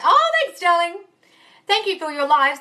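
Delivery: high, loud, flattered squeal; delighted high-pitched thank-you; gushing thanks. Real, not acted. Gushing thanks for accent compliment.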